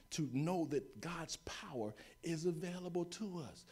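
voiceless hushed whisper. Real, not acted. Preacher whispering a core belief to the congregation, hushed. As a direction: breathe the words out to the church.

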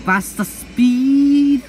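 loud, drawn-out yell; shouting. Real, not acted. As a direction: yell it out, stretched slowly. Yelling at the game.

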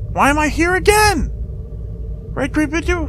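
high confused squeak; baffled squeak. Real, not acted. Player confused by reset, high baffled squeak. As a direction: pitch jumps up in disbelief.

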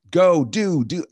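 high, loud, pushy nagging; bossy parent. Retold or imitated. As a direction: bark orders like a driving parent. Parent pushing kids to act.

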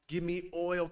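ordinary talk, nothing marked

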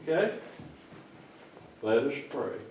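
normal talking voice

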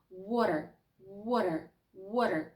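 water with the tongue tapping once, wa-rer; flap T water. Shown as a demonstration. Teacher repeating the flapped water for learners. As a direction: tap the T lightly in water. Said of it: "торкнутися ним швидесенько і легесенького до верхнього піднебіння".

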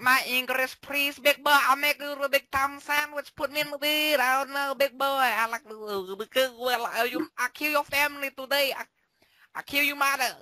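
high-pitched mock foreigner with broken grammar; mocking broken English. Player mocks the fan's broken English in a high silly voice. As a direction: lightly put on a silly high voice to mock the fan.

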